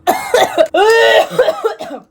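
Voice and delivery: loud, high hacking cough; sick cough. Real, not acted. Sick vlogger mid-ramble, loud hacking cough. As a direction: cough hard in a burst. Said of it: "Proof that I was sick".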